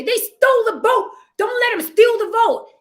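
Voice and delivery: high, loud mock rally cry; mock rally speech. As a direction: rile up the crowd from a podium. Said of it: "stand on a podium and say".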